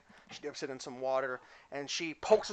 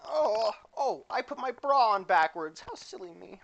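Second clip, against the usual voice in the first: high, whiny, mock distressed; fussy girly voice; 9 semitones higher, 4 dB louder than usual. Clumsy girl stuck in her bra, high mock whine. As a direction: whine high about the silly mistake.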